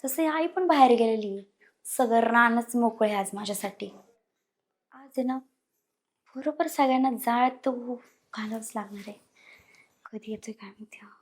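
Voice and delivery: low, very soft plotting; scheming to herself. Acted. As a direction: think aloud quietly and secretly. Plotting alone before husband arrives.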